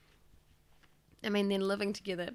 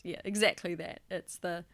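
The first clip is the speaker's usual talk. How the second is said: normal talking voice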